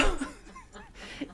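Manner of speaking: airy giggle, high up; high breathy giggle before the story; high breathy laugh. Real, not acted.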